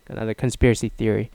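ordinary talk

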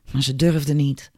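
ordinary delivery

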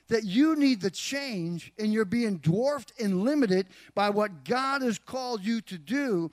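loud, driving sermon delivery with weight on key words; forceful preaching. Real, not acted. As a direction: press the point home to the congregation. Preaches application with more force.

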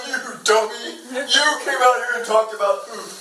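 put-on jeering taunt; mocking. Father pretending to mock his son, hammed-up jeer. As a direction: pretend to ridicule a small child. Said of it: "If you saw me mocking my son".

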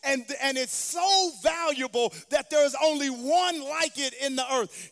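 full-force preaching. Real, not acted. Preacher building to a climax.

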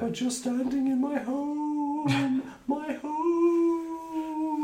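high, slow wailing wraith; ghostly voice. Acted. Marsh wraith accusing intruders in its home, high ghostly wail. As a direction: wail it high and stretch the last vowel. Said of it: "in his ghostly voice".

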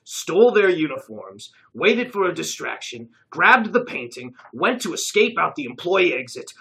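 smug deduction. A narrator's reading. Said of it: "a smile creeping onto his face".

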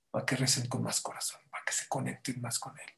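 hushed, confiding whisper. Real, not acted. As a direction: drop to a whisper. Intimate point of the sermon.

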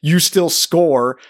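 usual speaking voice

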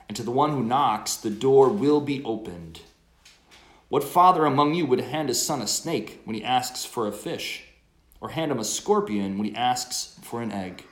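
loud, measured scripture reading; gospel proclamation. Acted.

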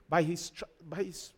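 unsure reciting. Acted. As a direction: fumble for the scripture and break off. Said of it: "No, not like that".

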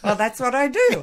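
mock husband, lazy and pleased with himself; teasing impression of a contented stay-at-home husband; husband's voice. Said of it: "he just thinks".

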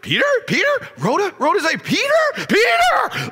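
high, rising, excited cries of the name; joyful shriek building to a shout; overjoyed gasp. Acted. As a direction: she can't believe it's him. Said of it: "overcome with joy".

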